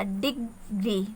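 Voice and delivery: डिग्री said slow and soft, syllable by syllable; quiet, careful डिग्री; softly spaced syllables. Shown as a demonstration. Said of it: "दो syllable word बन गया". (डिग्री धीरे और धीमी आवाज़ में, अलग-अलग सिलेबल)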